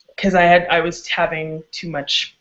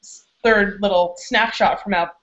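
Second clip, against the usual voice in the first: ordinary delivery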